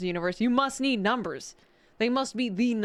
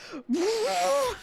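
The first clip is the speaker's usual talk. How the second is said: high-pitched burst of laughter; real high laughing fit; helpless laugh; 8 semitones higher than usual. Real, not acted. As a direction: crack up completely. Cracking up at chanting tank orcs.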